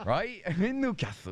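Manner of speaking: put-on a hammy Newcastle accent; Geordie accent. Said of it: "Can you do a Jody accent?".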